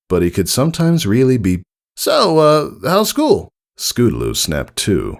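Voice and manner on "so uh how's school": high, hesitant pony voice with an uh; awkwardly making small talk; unsure Rainbow Dash. A narrator's reading. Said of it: "a bit unsure of herself".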